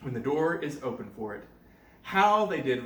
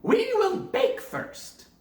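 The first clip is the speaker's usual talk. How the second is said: high slow witch voice; scheming witch; 5 dB louder, slower than usual. Acted. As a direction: savour the words like a plotting witch. Witch speaks.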